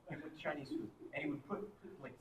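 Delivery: usual speaking voice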